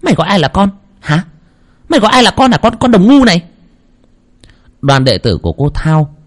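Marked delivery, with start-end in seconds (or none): 0.0-3.4 high, louder enraged shriek; furious possessed shriek; shrieking fury. Acted. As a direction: hiss it out in a rage at the medium. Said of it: "rít lên".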